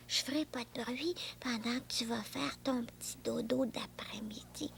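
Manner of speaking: voiceless, hushed; soft whisper by a nap. Acted. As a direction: whisper so the friend can sleep. Whispering to sleeping friend.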